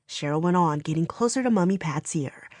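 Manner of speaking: plain reading voice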